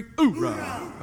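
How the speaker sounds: barked Marine oorah; gung-ho soldier oorah. Real, not acted. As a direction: give a manly oorah. Leads the manly cheer.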